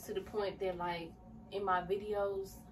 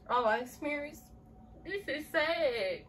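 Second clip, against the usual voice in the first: starting to cry; 7 semitones higher, 4 dB louder than usual. Real, not acted. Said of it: "I did not expect to cry".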